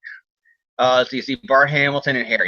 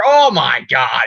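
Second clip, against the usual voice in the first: louder, drawn-out exclamation; big stretched gasp of disbelief; retold shock; 5 dB louder, much slower than usual. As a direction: cry out in slow mock horror. Peter's first shocked reaction.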